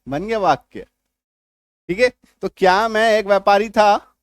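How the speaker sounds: ordinary talk, nothing marked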